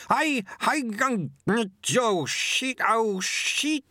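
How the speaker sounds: high, stammering panic, words tumbling out; the attacker realises who he faces and panics, high and stammering; panicked stammer. A narrator's reading.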